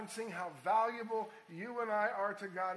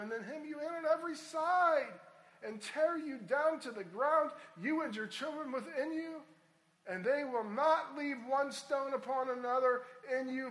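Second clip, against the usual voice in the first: building prophetic warning; 7 semitones higher, 7 dB louder than usual. Acted. Jesus' prophecy of destruction, read.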